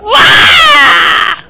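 high, loud battle cry; power up scream. Real, not acted. Scream during chase. (grito de poder)

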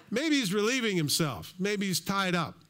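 high-pitched mock speculation, dragging at the end; Elijah's taunt. Elijah needling the dancing prophets, taunting. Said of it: "he taunts them".

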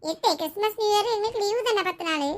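put-on high-pitched little kid; child's voice. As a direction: tell your aunt in a high kid's voice. Child figure tells of holidays. (உயர்ந்த குழந்தைக் குரல்)

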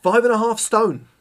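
high, loud astonished exclamation; surprised. Real, not acted. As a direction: blurt it out in disbelief. Surprised at heavy lens weight.

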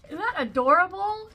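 high, loud squeal of delight; gushing. Real, not acted. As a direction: squeal it at the cute print. Gushing over bag print.

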